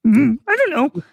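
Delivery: creepy clown calling down the sewer; Pennywise impression. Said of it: "Pennywise luring Kermit".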